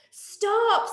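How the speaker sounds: high, sharp stop; alarmed. Acted. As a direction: stop them in sudden alarm. Monkey alarmed.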